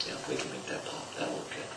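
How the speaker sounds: hushed calm agreement. Retold or imitated. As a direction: say it softly, no smile. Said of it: "I just calmly".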